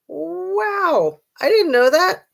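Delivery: sarcastic, high "wow"; mock surprise. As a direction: act stunned by the obvious. Mock amazement at obvious fact.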